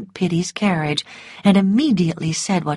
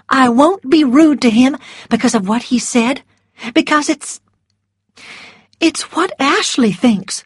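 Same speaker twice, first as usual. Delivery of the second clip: nervous; 7 semitones higher, 3 dB louder than usual. A narrator's reading. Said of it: "hands were shaking".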